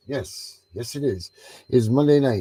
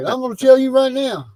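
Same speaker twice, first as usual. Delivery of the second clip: higher, louder put-on Southern voice; friend's Southern voice, mocked; mock Southern accent; 14 semitones higher, 6 dB louder than usual. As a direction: imitate a Southern guy claiming to be Irish. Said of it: "He's like".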